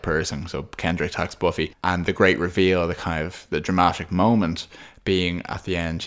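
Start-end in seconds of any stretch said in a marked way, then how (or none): none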